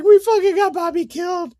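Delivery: high, squealing voice cracking with laughter; howling with laughter, barely able to speak; laughing through tears. Retold or imitated. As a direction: blurt it out while crying with laughter. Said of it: "I'm crying from laughing so fucking hard".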